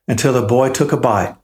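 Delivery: ordinary delivery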